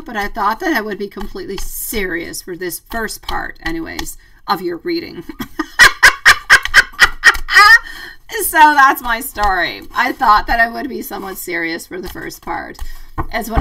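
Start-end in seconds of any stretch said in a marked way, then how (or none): 5.3-8.4 loud, airy burst of laughter; breathy laugh. Real, not acted. Viewers derailing her serious reading, breathy laughing fit. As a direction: laugh helplessly at the teasing.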